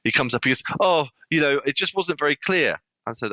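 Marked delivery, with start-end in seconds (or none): 0.8-2.8 high-pitched imitation of a put-out customer; complaining husband. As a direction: mimic his grumble, pitched well up. Retold husband complains about refund.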